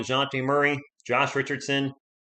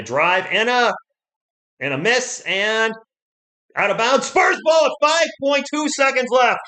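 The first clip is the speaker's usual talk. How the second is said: excited call; 9 semitones higher, 5 dB louder than usual. Real, not acted. Frantic final-seconds play call.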